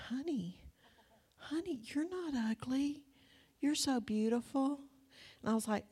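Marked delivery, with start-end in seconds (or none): 0.1-4.8 tender reassurance. Retold or imitated. Husband comforts her in story.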